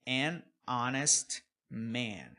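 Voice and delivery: "an honest man" devagar, H mudo. Shown as a demonstration. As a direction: diga "an honest man" sem soprar o H. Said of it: "aqui o H não tem som". (honest sem som de H)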